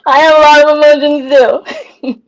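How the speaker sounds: loud, high, hammed-up cry; mock wail. Streamer joking about her own big emotions, mock-tearful wail. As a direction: playfully wail it, overwhelmed.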